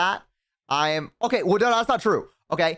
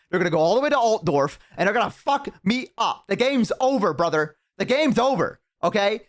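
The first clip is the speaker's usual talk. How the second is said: higher, worked-up doom; despairing rant; 5 semitones higher than usual. Real, not acted. Streamer tells chat the vampires will crush him, worked-up and higher. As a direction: rant that all is lost.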